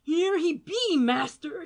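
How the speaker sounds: high, loud cracked crone call; old woman shrieking. A narrator's reading. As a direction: shriek it up the stairs. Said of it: "shrieked the old beldame".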